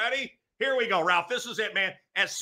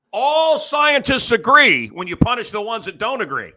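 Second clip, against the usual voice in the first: slow, loud, higher pundit proclamation; mock pundit impression; 5 semitones higher, 7 dB louder than usual. Comic doing his impression, mock proclamation. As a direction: proclaim it like a TV authority. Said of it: "Scientists, doctors agree".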